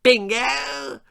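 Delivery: very high, loud horrified shriek; grand lady appalled by bingo; shrieking posh lady. A narrator's reading. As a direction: shriek it in snobbish disgust. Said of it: "shrieked Lady Davinia".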